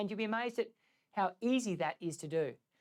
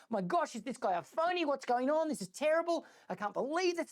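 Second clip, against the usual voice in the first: acted upset grumbling, higher-pitched; frustrated, stressed; 6 semitones higher than usual. Young monk fuming to himself about the old monk, acted frustration. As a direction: play a monk stewing in mock exasperation. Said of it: "he's upset and he's stressed and he's frustrated".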